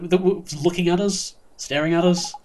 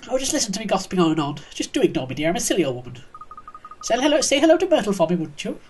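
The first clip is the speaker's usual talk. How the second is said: put-on lilting, apologetic old lady voice; old neighbour, self-deprecating; 5 semitones higher than usual. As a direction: fluster like a silly old dear. Neighbour excuses her gossiping.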